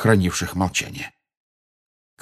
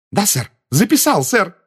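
high, loud, eager reply; lively; 12 semitones higher, 5 dB louder than usual. A narrator's reading. Assistant reports to the minister, lively. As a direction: answer keenly in a high voice. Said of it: "живо отозвался Перси".

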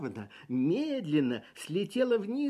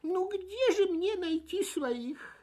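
plaintive whine; 12 semitones higher, slower than usual. A narrator's reading. Fip whimpering, lost.

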